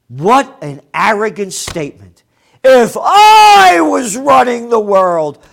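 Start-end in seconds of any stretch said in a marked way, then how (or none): 2.6-5.4 shouted high and loud, sneering; mock shout. Ranting at a billionaire, he shouts his words in mockery. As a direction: yell the quote back as a scornful parody. Mocking a billionaire's arrogant quote.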